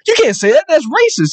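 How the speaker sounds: put-on high-pitched protesting child; high mock kid voice; imitated little kid. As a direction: object like an indignant small kid. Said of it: "this little kid goes".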